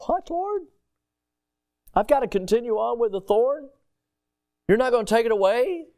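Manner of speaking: pitched high, Paul can't believe the answer; incredulous protest. Acted. Paul protesting to the Lord, high and incredulous. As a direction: play Paul aghast at keeping the thorn.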